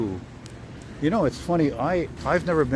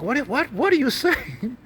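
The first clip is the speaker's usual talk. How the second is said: high, slow acted-out disbelief; mock incredulous retort; 12 semitones higher, 3 dB louder, slower than usual. Retelling a TV scene to a friend, high incredulous mimicry. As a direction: imitate a baffled character, pitched way up. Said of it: "And he's like".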